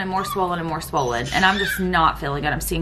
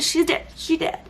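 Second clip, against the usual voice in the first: tearful; 7 semitones higher, 3 dB quieter than usual. Real, not acted. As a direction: voice pushed up high and strained, close to tears. Retelling finding baby dead.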